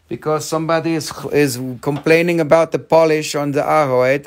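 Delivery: ordinary talk, nothing marked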